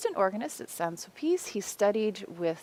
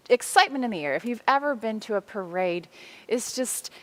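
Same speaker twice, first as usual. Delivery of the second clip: normal talking voice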